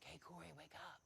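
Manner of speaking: put-on girl in his room at night, softly calling; hushed wake-up. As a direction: coax him awake without waking the house. Girl sneaks in to wake him.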